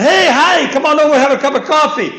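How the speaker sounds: high, loud, fast friendly call; hearty invitation. Retold or imitated. Preacher calling a passerby over for coffee, loud and eager. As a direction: wave him over warmly, laughing.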